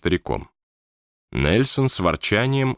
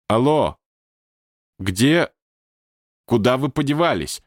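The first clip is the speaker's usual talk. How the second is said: higher, puzzled calls; bewildered calling; 6 semitones higher than usual. A narrator's reading. Wallace searching for vanished Nelson in the teashop, calling out. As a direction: call out into an empty room.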